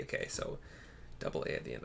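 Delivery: whispered aside to self; whispering the spelling to self. Real, not acted. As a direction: breathe it with no voice.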